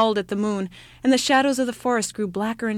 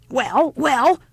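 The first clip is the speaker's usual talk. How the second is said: louder, higher deaf-granny shout; 5 semitones higher, 7 dB louder than usual. Acted. Old woman shouting at the child on her doorstep, loud. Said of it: "shouted the old woman".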